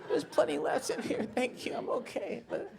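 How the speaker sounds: very high, soft, squeezed voice; mock straining, thin falsetto; strained squeaky husband. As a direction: squeak it out under effort.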